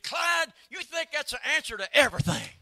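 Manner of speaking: high, loud exasperated shout; Mama's retort. Retold or imitated. Mama shouting at Daddy over going to bed. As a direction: snap back at the husband with a shout. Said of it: "she said".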